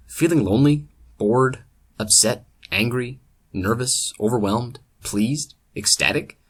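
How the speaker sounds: upbeat commercial announcer voice; ad read. Pretend. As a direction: sell it like a TV ad. Mock alcohol commercial read.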